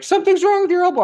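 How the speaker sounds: fast, high-pitched, loud alarm; imitated panic. Panicking stranger warns an injured man on the subway, mock shrill panic. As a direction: blurt it in hammed-up panic.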